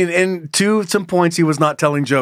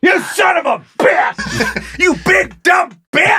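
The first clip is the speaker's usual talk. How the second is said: high, drawn-out insult shouting; hammed-up slow, high abuse; mock angry yelling; 3 dB louder, much slower than usual. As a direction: stretch each word into a high-pitched yell. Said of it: "Yell at Zach for me".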